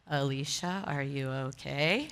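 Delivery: a hushed, worried whisper; concerned whisper. Retold or imitated. Colleagues quietly asking Alicia if she's okay, whispered. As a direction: lean in and check on her gently, under your breath. The guys checking on her.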